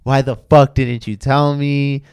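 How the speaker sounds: loud, indignant yelling; angry outburst. Retold or imitated. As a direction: yell it at him, furious. Girlfriend's angry retold reaction.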